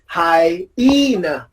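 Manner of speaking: hyena, slow, with a long stressed EE in the middle; carefully modeled hy-EE-na. Shown as a demonstration. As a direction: say hyena drawn out as hy-EE-na. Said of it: "very good. It's".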